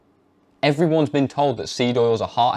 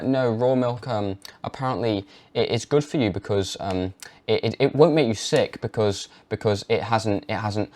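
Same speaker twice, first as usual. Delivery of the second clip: unsure rambling. Shown as a demonstration. Weak way to pitch raw milk to parents, hesitant. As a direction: stumble and hedge. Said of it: "if you're saying it like that".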